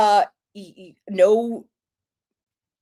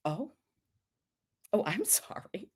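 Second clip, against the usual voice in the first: whispered mock apology; hushed, unvoiced fake regret; 11 dB quieter than usual. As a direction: whisper it as a coy pretend sorry. Mock apology before risqué question.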